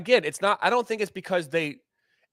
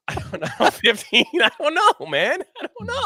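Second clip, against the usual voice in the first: "I don't know" broken up by real laughter; laughing; 10 semitones higher than usual. Real, not acted. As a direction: giggle through the words, pitch jumping up. Laughing over a silly complaint.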